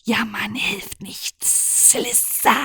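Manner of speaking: leise geflüstert, langsam; self pep talk. Acted. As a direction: sich selbst flüsternd Mut machen. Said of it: "flüsterte sie sie selbst zu". (geflüsterte Selbstermahnung)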